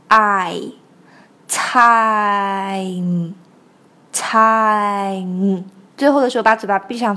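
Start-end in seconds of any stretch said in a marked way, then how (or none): 0.1-5.6 TIME stretched very slowly through ah-ee into m; diphthong demo. Shown as a demonstration. Teacher shows the double vowel, diphthong demo. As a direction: draw TIME out, humming the final m. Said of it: "发time的时候要注意双元音".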